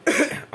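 sharp real cough; loud single cough; coughing fit. Real, not acted. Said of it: "Anda batuk terus".

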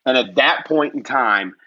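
normal talking voice

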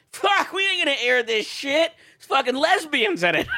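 high, hammed-up indignant refusal; mock outrage. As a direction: blurt it in mock disgust, close to laughing. Acting out China's outraged refusal.

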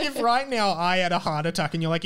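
usual speaking voice

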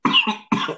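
sudden choking cough; coughing fit. Real, not acted. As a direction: cough after swallowing wrong. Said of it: "ich hab mich verschluckt".

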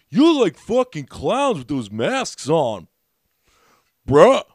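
loud, slow, taunting biker voice; loud drunk heckle. Retold or imitated. Retelling the biker heckling comics, loud and slow. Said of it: "and he goes".

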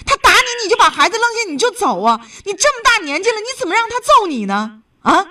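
high-pitched, indignant reproach; worked-up, incredulous telling-off; exasperated scolding. Real, not acted. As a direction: scold her out of real concern. Host scolds caller for enduring beatings.

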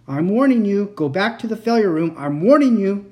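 loud, threatening warning cry; angry warning. A narrator's reading. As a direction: warn him, loud and fierce. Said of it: "Victor One cried".

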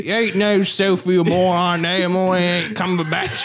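slow, high Cockney holler; garbled, hammed-up Cockney outburst; Benedict Cumberbatch. Disowning his wife, mock Cockney shouting. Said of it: "He's gone full Cockney".

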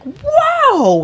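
high loud alarmed whoa; startled whoa. Retold or imitated. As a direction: yelp it in shock. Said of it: "freaking, like".

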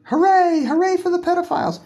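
hammed-up cheerleader whoop; mock cheer. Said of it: "I'm not".

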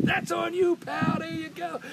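high, loud taunt stretched at the end; teasing heckle. Retold or imitated. Hockey star shows off his ring to fan, loud taunt. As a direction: crow it at a rival fan.

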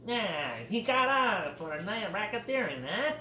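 put-on old-timey 1920s gangster talk, high and loud. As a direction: put on a jaunty Roaring Twenties voice. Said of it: "That's my nineteen twenties voice".

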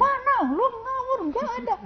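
very high, loud panicked denial; startled denial. Retold or imitated. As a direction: jump up flustered and deny it. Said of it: "Dia melonjak dari tempat tidurnya". (menyangkal dengan panik, sangat tinggi dan keras)

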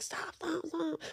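very soft mock "stop"; hushed, half-hearted imitation of a protest; retold protest. Photographed friend fending off the paparazzi, barely voiced protest.

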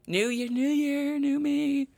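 high sing-song slogan voice; mock slogan. Parodying New Year slogans to his listeners, sing-song. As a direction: parody a cheesy self-help motto.

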